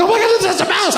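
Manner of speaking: high panicked shriek, hammed up; mock shriek. Husband retelling his own panic at the mouse, mock shriek. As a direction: squeal in mock terror. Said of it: "I shrieked louder than my wife".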